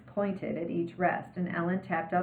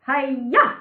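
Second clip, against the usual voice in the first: sharp high shouted kiai; loud karate kiai shout; karate yell; 7 semitones higher, 9 dB louder than usual. Acted. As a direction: belt out a martial arts yell. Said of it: "always yell like this when you kick".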